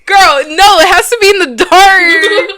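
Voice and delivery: high, loud real objection; loud protest. Real, not acted. Host protesting to co-host about the light, high loud shout. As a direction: cry out high to stop them.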